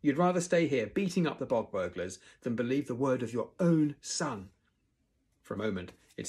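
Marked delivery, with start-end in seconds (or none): 0.0-4.5 high, loud indignant protest; indignant outburst. A narrator's reading. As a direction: protest hotly, pushing hard at the start. Argues with his father.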